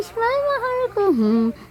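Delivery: very high, sing-song coaxing. Real, not acted. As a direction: squeak it up high to get a smile. Coaxing baby to smile.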